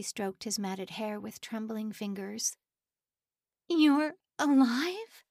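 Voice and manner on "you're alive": high, slow squeak of disbelief; squeaky voice. A narrator's reading. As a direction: voice squeaks with shock. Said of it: "she squeaked".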